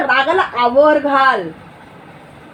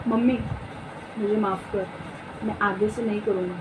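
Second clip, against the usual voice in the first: low, soft plea for forgiveness; gentle answer; 6 semitones lower, 11 dB quieter than usual. Acted. Son pleading with his angry mother, gently and softly. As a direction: play a meek, contrite son speaking softly. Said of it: "कोमल उत्तर दिया।".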